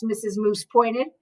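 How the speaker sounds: ordinary delivery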